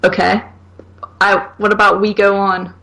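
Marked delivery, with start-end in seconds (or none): none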